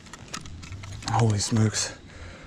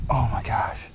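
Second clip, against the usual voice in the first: whisper. Real, not acted. Hooked giant fish, whispering.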